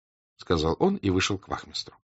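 usual speaking voice

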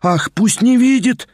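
high frantic outcry, like a madwoman; frantic cry. A narrator's reading. Liza crying out madly to flee from Mavriky. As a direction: cry it out wildly, pitch up. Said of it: "вскричала вдруг Лиза, как безумная".

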